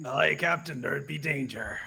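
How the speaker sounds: lightly voiced pirate; a hint of an old sea dog calling out. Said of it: "you hear a pirate voice just go".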